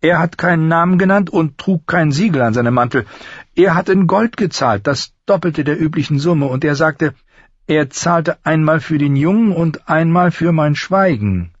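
very high craftsman voice; high-voiced smith owning up; master armourer, confessing. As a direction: explain yourself to the lord, voice pitched high.

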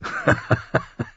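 short derisive laugh; sneering, dismissive chuckle; scornful laugh. A narrator's reading. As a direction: laugh mockingly at the gift. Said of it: "lachte höhnisch".

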